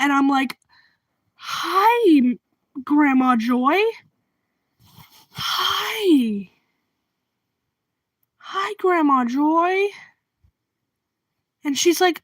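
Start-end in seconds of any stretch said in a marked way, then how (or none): 1.4-9.9 halting, stretched-out hesitant hello; mildly uneasy polite reply, pitched up; awkward greeting. Retold or imitated. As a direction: greet her haltingly, with long pauses. Acts own awkward reply to neighbor.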